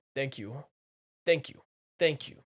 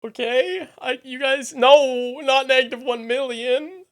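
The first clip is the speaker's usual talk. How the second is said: loud protest; 9 semitones higher, 10 dB louder than usual. Real, not acted. Protesting chat's aura scores.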